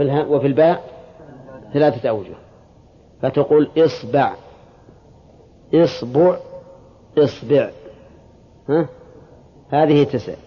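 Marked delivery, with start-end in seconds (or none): 3.8-4.3 "isba'", opening on i with a after the b; kasra, then fatha. Shown as a demonstration. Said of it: "كسر الهمزة". 5.7-6.4 "isbu'", opening on i with u after the b; kasra, then damma. Shown as a demonstration. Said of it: "كسر الهمزة". 7.2-7.7 "isbi'", i before and after the sb; kasra on both. Shown as a demonstration. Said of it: "كسر الهمزة".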